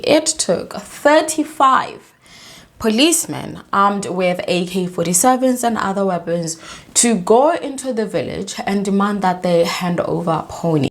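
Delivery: ordinary delivery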